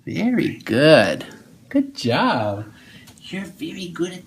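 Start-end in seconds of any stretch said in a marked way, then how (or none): 3.2-4.2 whispering. Real, not acted. Praising child during exam.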